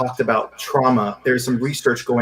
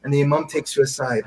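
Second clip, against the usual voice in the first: ordinary delivery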